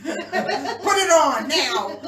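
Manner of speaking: high, loud, slow laughing order; laughing demand. Retold or imitated.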